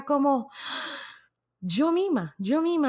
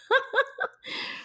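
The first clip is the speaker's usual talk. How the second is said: a high laugh; a quick, amused laugh. Real, not acted. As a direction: laugh at the slip. Laughs after cutting herself off.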